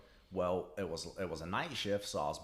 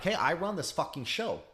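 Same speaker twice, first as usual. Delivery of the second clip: mock boss bluster; 8 semitones higher, 3 dB louder than usual. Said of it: "They're like".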